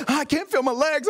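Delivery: high-pitched imitation of a panicking passenger; mock panic, as if losing control; freaking out. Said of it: "the guy was freaking out".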